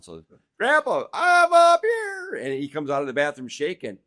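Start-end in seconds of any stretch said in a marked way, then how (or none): 0.6-2.4 put-on high, slow old-man call from upstairs; imitating grandpa calling. Grandpa answering the family from the upstairs bathroom, high and slow. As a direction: call down in a stretched, much higher grandpa voice.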